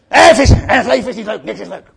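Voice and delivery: loud sulky grumbling; mock grouchy complaint; grumpy. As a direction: burst out, then grumble on. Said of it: "zagrijnig zitten vissen".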